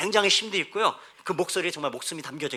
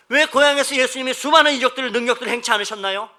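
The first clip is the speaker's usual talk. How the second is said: loud, raised sermon voice; forceful preaching; 6 semitones higher, 11 dB louder than usual. Acted. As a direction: proclaim it to the whole congregation. (높고 크게 선포하는 설교조)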